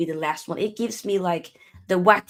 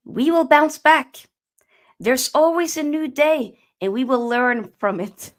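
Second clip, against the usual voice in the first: put-on Dutch manager's accent, measured and emphatic; Ten Hag; 3 semitones higher, 4 dB louder than usual. Manager rallying his beaten team, Dutch-accent impression. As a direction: deliver a deliberate pep talk in a Dutch accent. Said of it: "Ten Hag".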